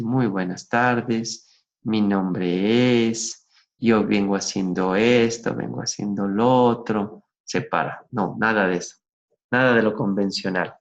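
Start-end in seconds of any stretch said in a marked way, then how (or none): 0.0-7.2 boring conventional intro. Shown as a demonstration. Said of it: "En lugar de decir".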